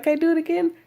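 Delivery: high, little pleading voice; imitated eager helper. Helper asking to give another shot, eager mimicry. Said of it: "She's like".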